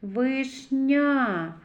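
Вишня slowed, weight on the soft Н in НЯ; stress on soft Н. Shown as a demonstration. Teacher isolates the soft consonant, Н emphasized. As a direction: lean into НЯ in Вишня. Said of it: "н, м'який приголосний".

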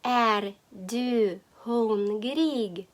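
"Är du hungrig" very slowly, each word apart. Shown as a demonstration. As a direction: dictate "Är du hungrig" with drawn-out syllables. New phrase dictated slowly. («Är du hungrig» дуже повільно, по словах)

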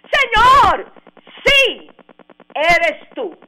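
high, loud eager cry of recognition; impulsive cry. Retold or imitated. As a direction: burst out in excited recognition. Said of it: "el más impulsivo de todos".

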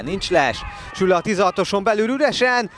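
high, loud call, slowing on the miss; excited hungarian sports commentary. Real, not acted. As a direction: build up, then drag out the letdown. Open chance in the box missed.

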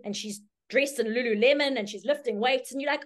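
normal talking voice

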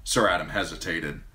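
plain reading voice

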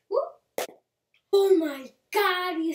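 loud, slow thrilled yell; excited shout. Real, not acted. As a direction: cheer at the landed flip. Trick lands, excited outburst.

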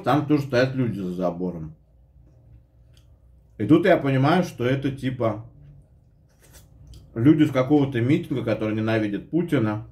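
usual speaking voice

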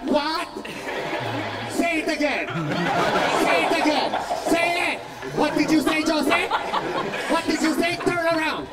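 high, loud scolding mom; yelling mom. Retold or imitated. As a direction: demand answers like an angry mother. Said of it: "only language my mom spoke was yell".

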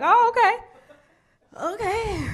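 high, slow drawn-out okay; drawn-out mock surprise. Seeing police ride by again, high drawn-out nervous okay. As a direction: stretch the okay, startled.

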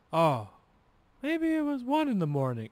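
high, wandering voice of a confused father; delirious dad. Retold or imitated. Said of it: "saying nonsense".

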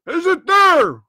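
loud, high roared question; roaring. A narrator's reading. Blind man demands news of the money, roaring. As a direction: roar the demand up at him. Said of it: "roared Pew".

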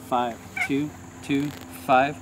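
slow. Real, not acted. Radio readback of grid coordinates.

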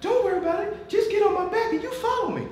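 very high falsetto imitation; comic high-pitched reassurance; Lorenzo Neal. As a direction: mimic a little man's high, squeaky voice. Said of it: "but he had a high voice".

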